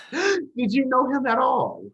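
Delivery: high, loud, slow incredulous question; exasperated disbelief. Acted. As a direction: throw it at him, high and drawn out. Exasperated retort to the father.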